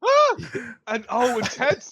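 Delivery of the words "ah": short high shrieked "Ah!"; imitated scream. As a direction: let out a sudden high scream. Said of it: "Beer lets out that scream".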